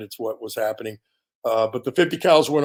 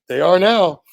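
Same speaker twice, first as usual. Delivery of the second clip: smug comeback; 5 semitones higher, 7 dB louder, slower than usual. Retold or imitated. Defiant comeback to the FBI.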